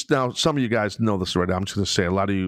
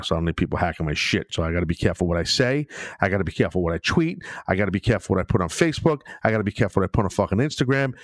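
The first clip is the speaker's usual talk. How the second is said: fast; 3 semitones lower, faster than usual. Real, not acted. Rapid list of cautions.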